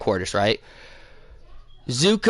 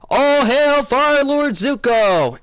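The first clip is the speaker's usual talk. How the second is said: high, drawn-out ceremonial cry; crowd proclamation; 11 semitones higher, 4 dB louder, much slower than usual. Retold or imitated. Crowd hailing the new Fire Lord, high proclamation. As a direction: proclaim it slowly in a raised, ringing chant.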